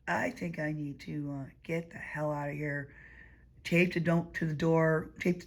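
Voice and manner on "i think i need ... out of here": quiet. Retold or imitated. Deciding to leave, quietly.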